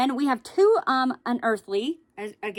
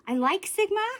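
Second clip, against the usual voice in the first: high, louder insistence; emphatic; 10 semitones higher, 5 dB louder than usual. Real, not acted. Vlogger insisting to viewers she likes the brand, high and emphatic. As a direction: insist on it brightly.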